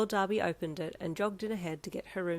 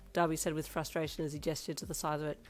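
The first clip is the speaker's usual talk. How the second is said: ordinary delivery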